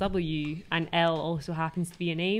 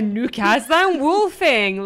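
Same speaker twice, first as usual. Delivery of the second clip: much higher, much louder, slow announcement of the name; grand credits read-out; 6 semitones higher, 11 dB louder, slower than usual. Shown as a demonstration. Reading the funny names off the film credits, a loud slow grand announcement. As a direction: boom the name out like rolling credits. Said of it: "read out the ones that catch your eye".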